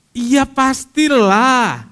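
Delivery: loud, high, drawn-out exclamation; emphatic retort. Acted. Preacher answers those saying they pray, emphatic retort. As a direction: stretch it out as an obvious yes.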